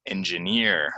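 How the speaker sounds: "Engineer" said en-juh-NEER; last-syllable stress. Shown as a demonstration. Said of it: "goes on the last syllable".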